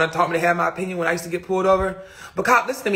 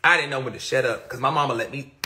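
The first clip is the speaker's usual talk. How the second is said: normal talking voice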